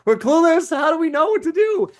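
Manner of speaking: high, loud hammed-up helpless voice; mock clueless. Coach voices clueless students, high mock helplessness. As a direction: whine it like a lost beginner.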